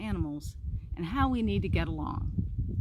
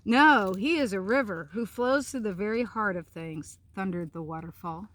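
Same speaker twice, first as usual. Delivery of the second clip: story narration; 5 dB louder than usual. A narrator's reading. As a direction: read it like a bedtime tale, savouring each line. Reads picture book aloud.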